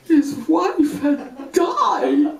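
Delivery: high slow wobbly voice, half laughing; breaking into laughing cry. Real, not acted. Speaker recalling the dead wife, voice breaking with laughter. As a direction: let the voice crack between laugh and tears. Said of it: "I started to cry".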